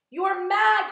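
very high, loud yell; loud, appalled cry. A narrator's reading. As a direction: shriek it in disbelief. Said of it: "cried the earthworm".

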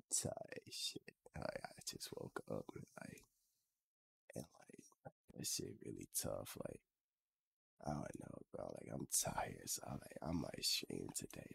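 very low, hushed, sleepy mumble; fuckboy morning voice. Acted. Streamer imitating his friend's morning voice, low sleepy fuckboy murmur. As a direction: murmur it softly like you just woke up. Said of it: "a fuckboy voice".

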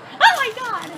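high shocked yelp; ice on skin. Real, not acted. Ice put on himself.

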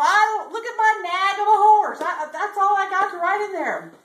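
high, loud cowboy complaint; dismayed protest. Acted. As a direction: wail about it in hammed-up dismay. Bubba complains about his horse.

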